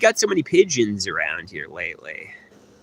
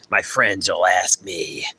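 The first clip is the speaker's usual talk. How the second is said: usual speaking voice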